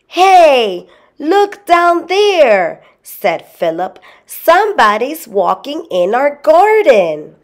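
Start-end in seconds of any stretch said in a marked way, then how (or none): 0.1-2.8 put-on high, loud, stretched-out boy's call. A narrator's reading. Boy calls out from the sky. 4.3-7.3 high-pitched, excited report; alarmed boy. A narrator's reading. Boy raises alarm about intruder.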